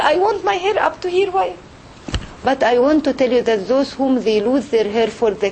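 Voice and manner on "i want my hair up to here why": higher-pitched upset complaint; mock upset protest, pitched up. Client complaining about a too-short haircut, upset. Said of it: "You get very upset".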